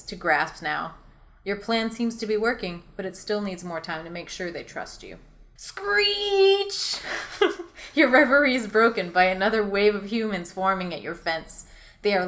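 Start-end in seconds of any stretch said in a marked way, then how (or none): 5.6-7.0 monkey screech. Acted. Narrator voices a monkey screech.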